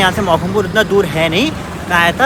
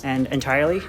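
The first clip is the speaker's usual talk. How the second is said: slow, careful put-on American accent; showing off an American accent, slowly; much slower than usual. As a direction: speak English in a deliberate American accent. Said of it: "I speak in American accent".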